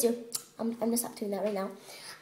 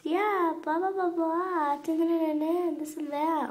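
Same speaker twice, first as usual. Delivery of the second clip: mock chatter; 5 semitones higher, 3 dB louder than usual. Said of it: "I'm like".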